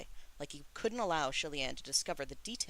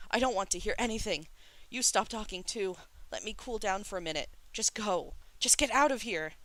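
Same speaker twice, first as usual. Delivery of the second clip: raised, high agitated voice; distraught outburst; 6 semitones higher, 5 dB louder than usual. A narrator's reading. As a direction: drive them all away, building to a yell. Distraught brother ordering everyone away.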